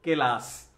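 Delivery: ক্লাস broken into two syllables, "ke-las"; the mistaken "ক্লাস", as "ke-laas"; wrong split cluster. Shown as a demonstration. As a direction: say "ক্লাস" with an extra vowel after K.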